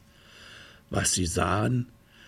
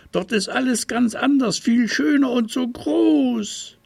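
put-on raised, bright childlike bear voice; little bear voice; 4 dB louder than usual. A narrator's reading. Little bear telling the crow about dreamland, high eager voice.

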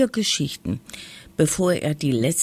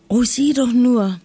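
high, louder, thrilled cry; delighted call; 7 semitones higher, 5 dB louder than usual. A narrator's reading. Lisa to her brother about the cat, delighted call. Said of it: "rief Lisa begeistert".

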